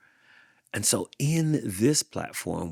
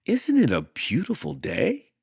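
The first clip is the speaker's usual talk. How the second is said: cheerful; 9 semitones higher, 4 dB louder than usual. Acted. Rosie greeting Hank.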